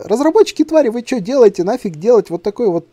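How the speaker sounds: high, loud mock scolding; indignant rant. Gamer berating absent developers over a boss fight, indignant. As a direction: tear into them in exasperated protest.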